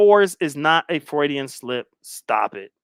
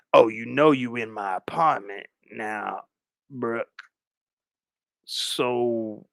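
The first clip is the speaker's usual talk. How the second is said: creepy stalker; 5 semitones lower, slower than usual. Acted. Stalker luring a guest in his apartment, low and creepy. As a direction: draw it out low and slow, like a stalker.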